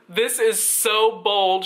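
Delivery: high, slow imitation of amazement; mock-astonished drawl; amused disbelief. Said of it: "the lady starts laughing in front of me".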